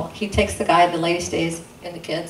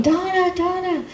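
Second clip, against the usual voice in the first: much higher, imitating calling down a hall; yelling a name; 13 semitones higher than usual. Parents search the hospital floor for the nurse, a much higher mock yell.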